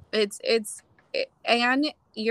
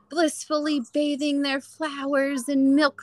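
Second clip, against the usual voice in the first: higher, slow, sugary parody of blissful moms; dreamy mock; 5 semitones higher, 3 dB louder, slower than usual. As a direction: lampoon the perfect Instagram mom. Mocking idealised mom photos.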